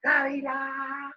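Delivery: high, long held call; shouting. Retold or imitated. Dad still hailing the hawk overhead, long high shout. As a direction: stretch the name out like a faraway hail. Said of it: "le está gritando al gavilán".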